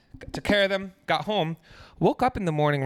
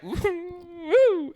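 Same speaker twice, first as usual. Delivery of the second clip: put-on old lady catcall; 11 semitones higher than usual. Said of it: "This old lady in front of me".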